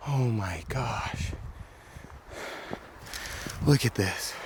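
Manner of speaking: soft, slow, amazed; hushed wonder at the sight; awed hush. Real, not acted. Awed by the rough road.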